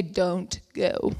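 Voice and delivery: low, soft mock warning; hushed warning. Officials reverse their advice to her, low quiet warning. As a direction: drop it down quietly, like a caution.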